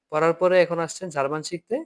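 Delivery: normal talking voice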